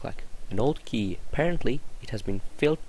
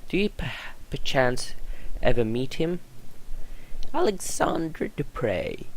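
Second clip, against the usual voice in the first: ordinary delivery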